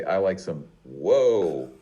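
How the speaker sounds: "whoa" for water, stretched very long; Cockney touch. Shown as a demonstration. Trying the phrase for the teacher, drawn-out Cockney touch. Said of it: "with that Cockney touch".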